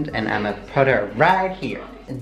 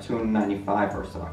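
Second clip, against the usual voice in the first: ordinary talk, nothing marked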